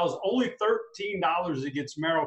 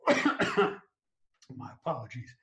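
slow, strained after coughing; choking; much slower than usual. Real, not acted. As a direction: apologize while still choking. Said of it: "I got you so excited you're choking".

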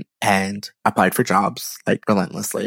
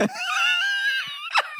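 big high laugh; 6 dB quieter than usual. Real, not acted. Laughing at her own story.

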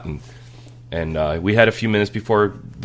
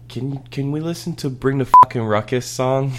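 put-on little girl voice; 7 semitones higher, 3 dB louder than usual. Daughter quoted asking in car.

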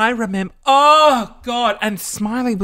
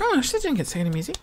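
quick, high, soft aside; acted private surprise, kept under the voice; hushed realisation; 6 semitones higher, 6 dB quieter, much faster than usual. Teller realising the rival missed out, hushed quick aside.

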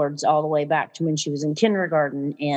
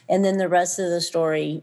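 normal talking voice

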